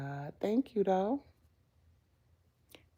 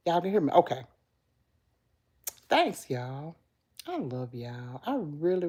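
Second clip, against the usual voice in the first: ordinary talk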